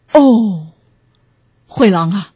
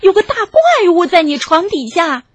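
high-pitched alarmed cry; shouting piglet; 7 semitones higher than usual. A narrator's reading. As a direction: shout the warning in a squeal. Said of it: "小猪喊了起来".

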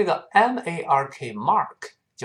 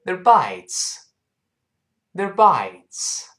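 快读弱化；They're一带而过，bites响亮高扬；前轻后重地连读They're bites；快读示范，重音落在bites; 5 semitones higher, 5 dB louder, much slower than usual. Shown as a demonstration. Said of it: "快读，注意发音变化".

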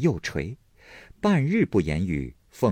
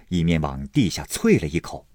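ordinary delivery, nothing marked

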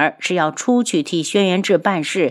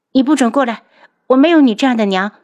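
higher, louder and fast, shoving the words away; angry rejection; 6 semitones higher, 5 dB louder, much faster than usual. Acted. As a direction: refuse her outright, voice rising. Backs away, rejects mother. (愤怒地拒绝，声音拔高、语速加快)

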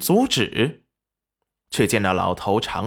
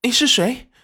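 high-pitched, startled question; high voice of someone pulling away; struggling, startled; 12 semitones higher than usual. A narrator's reading. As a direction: ask in alarm, pitched well up. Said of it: "挣扎着说道".

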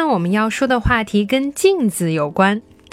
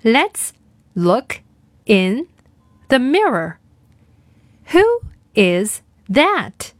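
each word said alone with long pauses; slower than usual. Shown as a demonstration. Teacher reading word by word for a repeat-after-me drill. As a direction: space every word out for kids to follow. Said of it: "我们来练习今天的跟读作业".

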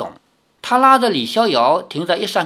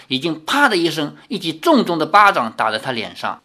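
usual speaking voice

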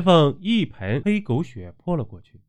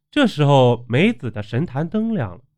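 usual speaking voice

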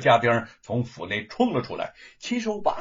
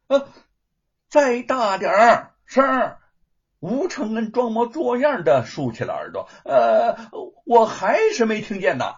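teasing mock deafness; 9 semitones higher, 7 dB louder than usual. Mocking, pretending not to hear.

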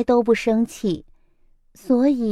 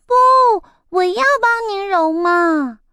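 insistent little chick; 8 semitones higher than usual. A narrator's reading.

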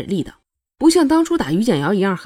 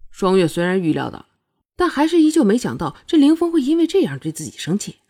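ordinary delivery

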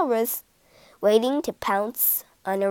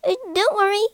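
higher, squeaking little rat voice; squeaky rat voice; 9 semitones higher, faster than usual. A narrator's reading. Greedy rat fibbing to the cat, squeaky voice. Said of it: "squeaked the rat".

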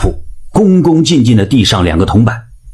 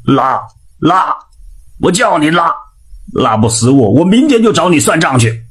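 cursing that builds higher in pitch; angry cursing; 5 semitones higher than usual. A narrator's reading. Old man on the toilet cursing the pharmacist, angry. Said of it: "他气狠狠地骂道".

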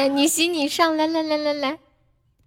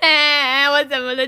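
laughing; 3 semitones higher than usual. Real, not acted. Laughing at failed meow.

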